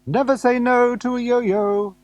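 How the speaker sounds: loud, very high vendor's call; yelled sales cry. Acted. Yo-yo man to people rushing out of their houses, yelling. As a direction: yell it out high like a street hawker. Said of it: "as he yells out".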